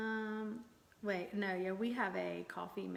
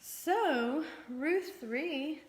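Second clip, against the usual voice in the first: loud, higher announcing voice; too loud; 6 semitones higher, 3 dB louder, much slower than usual. Real, not acted. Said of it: "Baby, inside voice".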